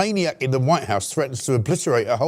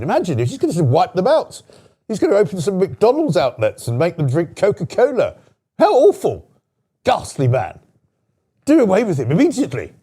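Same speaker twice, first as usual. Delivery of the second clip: hammed-up horror, louder, ending fast and high; mock media outrage; 5 semitones higher, 4 dB louder than usual. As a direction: mimic prissy pearl-clutching critics. Mocking the anti-Trump media's horror.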